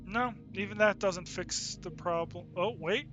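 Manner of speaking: ordinary delivery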